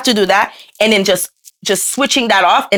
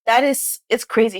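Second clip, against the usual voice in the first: ordinary delivery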